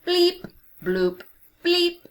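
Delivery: beeping robot, last bleep high and loud. A narrator's reading. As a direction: play a little dancing robot. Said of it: "When Robot Pete speaks".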